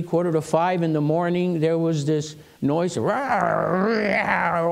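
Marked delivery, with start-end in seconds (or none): 3.0-4.7 drawn-out imitated animal roar; wild animal noises. Retelling the frightening noise before dawn, imitated wild animal roars. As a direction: growl and roar like a beast. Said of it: "all these wild animal noises".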